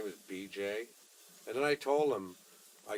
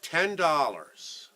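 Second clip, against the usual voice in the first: drawn-out offer; 6 semitones higher, 9 dB louder, much slower than usual. Retold or imitated. Deliberate offer after eye contact.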